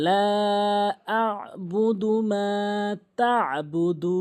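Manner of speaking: slow tajweed with long held vowels; Quranic recitation. Shown as a demonstration. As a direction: chant the verse slowly, stretching each madd. Teacher recites the verse as model.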